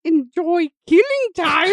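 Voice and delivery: put-on high, slow old witch voice. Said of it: "your line as the old witch".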